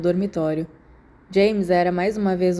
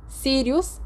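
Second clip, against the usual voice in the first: thin cold voice; 6 semitones higher than usual. A narrator's reading. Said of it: "Uma voz fina e fria".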